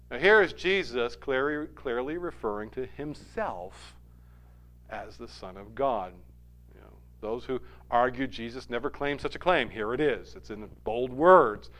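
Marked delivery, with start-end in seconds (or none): none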